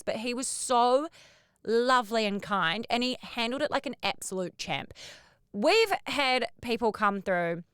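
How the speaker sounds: ordinary delivery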